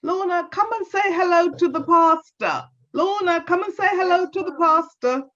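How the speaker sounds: high calling granny voice. Acted. Granny calling Lorna to greet the pastor, high and calling. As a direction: call the child across the house. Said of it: "called to Lorna".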